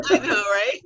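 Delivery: high laughing agreement; giggling I know right. Real, not acted. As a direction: laugh through the words. Laughing together.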